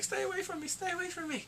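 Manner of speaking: high, pleading daughter; mock frightened plea. Tied-up daughter begging her father to stay away, high plea. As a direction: beg him to stay back in a high voice.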